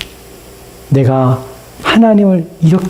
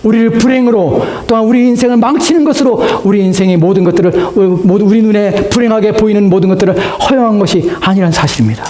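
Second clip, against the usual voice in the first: preaching at full force; 7 semitones higher than usual. Acted. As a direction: build steadily into a high, ringing proclamation. (힘주어 높이 외치는 설교)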